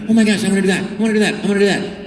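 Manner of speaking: high, eager pleading; mock-childish begging to play every part; eager actor. Actor begging to do every part, high and eager. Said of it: "I'm like".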